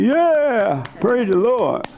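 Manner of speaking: high, excited congregation shout; mock amen corner praise; church audience cheer. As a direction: call out praise like the crowd.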